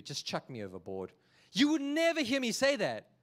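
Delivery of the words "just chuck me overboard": low and soft, giving up; resigned mumble. Acted. Jonah telling the sailors to throw him overboard, low resigned mumble. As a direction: say it like there's no way out.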